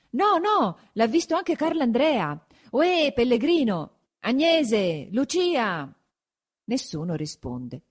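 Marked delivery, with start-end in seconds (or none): 0.1-5.9 high protest turning into hailing; insistent calling. A narrator's reading. Villagers looking for Agnese and Lucia, high insistent calls. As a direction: pitch it well up, calling for the missing. Villagers argue, call the missing.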